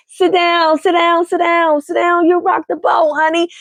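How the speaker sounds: sassy sit down. Retold or imitated.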